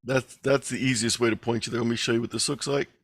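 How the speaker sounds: ordinary talk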